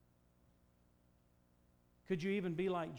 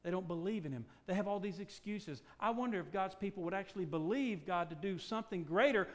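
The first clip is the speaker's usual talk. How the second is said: usual speaking voice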